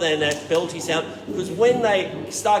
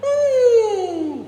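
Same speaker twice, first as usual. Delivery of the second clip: even higher, louder falsetto ooh; 8 dB louder than usual. Shown as a demonstration. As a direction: push the hoot up a step. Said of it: "a little bit higher".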